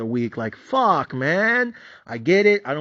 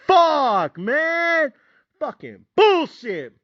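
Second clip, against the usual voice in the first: high, loud frustrated outburst; heated frustration; 11 semitones higher, 4 dB louder, much slower than usual. Retold or imitated. Watching best friend's stock climb, a loud high frustrated curse. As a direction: vent it like you just missed out. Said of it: "I was, I was heated".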